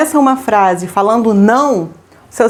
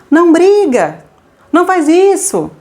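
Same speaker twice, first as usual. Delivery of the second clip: scolding parent; 6 semitones higher, slower than usual. Retold or imitated. Mother scolding her son, mock scolding higher. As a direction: snap at the kid, half-acted. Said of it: "se você diz assim pro seu filho".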